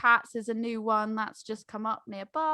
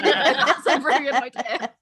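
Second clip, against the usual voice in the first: laughing mock praise; 3 semitones higher, 6 dB louder, slower than usual. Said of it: "We'll just go".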